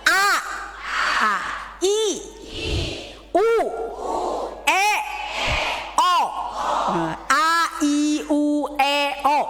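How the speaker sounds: A, I, U, E, O fully articulated; vocal drill, each vowel wide and distinct; clear articulation. Shown as a demonstration. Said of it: "Ya, jelas".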